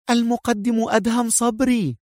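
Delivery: high, weighty announcement; momentous. A narrator's reading. Cadet revealing the lecturer to his friend, high and momentous. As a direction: reveal the name as something huge. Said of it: "لهجة تشف عن خطورة الأمر".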